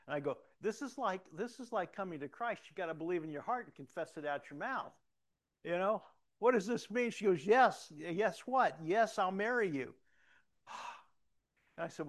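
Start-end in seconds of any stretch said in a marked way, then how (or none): 0.6-7.1 higher, louder, eager rush of excitement; excited. Retold or imitated. Excited proposal retold. 7.5-10.0 higher, louder joyful acceptance; excited yes. Retold or imitated. Wife accepting proposal.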